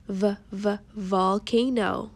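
slow, sounding out the V at the start of "volcano"; sounding out slowly. Shown as a demonstration. Teacher sounding out "volcano" slowly for children. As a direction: stretch "V, v, volcano" slowly so kids hear the V. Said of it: "repeat after me".

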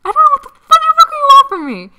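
high furious yell; angry shout. Acted. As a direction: scream it in exasperation. Hana's exasperated outburst.

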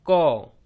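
कौ as k plus open 'aw'; blended syllable demo. Shown as a demonstration. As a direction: model कौ for learners. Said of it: "तो बन गया".

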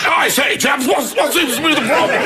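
high, hammed-up English officer shout; retired English colonel. Colonel stepping in at a French restaurant, blustering. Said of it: "this retired English colonel".

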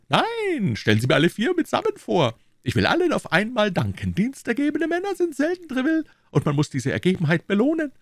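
very high-pitched king's voice; magnanimous king. Acted. King wanting to thank all four men, eager high voice. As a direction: decree it eagerly in a high royal voice.

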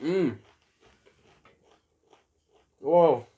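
muffled closed-mouth hum; savoring food while chewing; mouth full, chewing. Real, not acted. Seen 0: cheeks puffed, lips closed, chewing after bite at -5; R frames talk freely.

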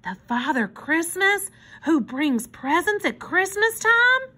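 put-on high child's question, climbing at the end; amazed, rising to a high finish; awed wonder. A narrator's reading. Susie checking which Father Christmas, wondering disbelief. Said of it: "exclaimed in a hushed voice".

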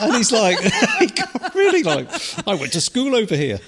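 laughing while talking. Real, not acted. Laughing through retold quote.

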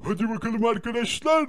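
usual speaking voice

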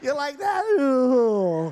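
high, loud, very slow; drawn-out puppet question. Acted. Ventriloquist's dummy asking the crowd, high, loud and very slow. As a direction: stretch it out to the crowd.

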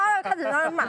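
ordinary talk

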